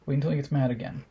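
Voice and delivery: usual speaking voice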